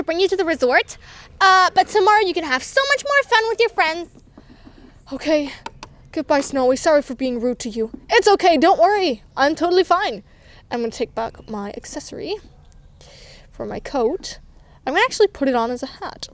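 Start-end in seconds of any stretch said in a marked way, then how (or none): none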